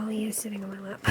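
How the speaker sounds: hushed whisper, cat on lap. Real, not acted. Whispering to viewers while the cat sits on her lap. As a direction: whisper so the cat stays settled.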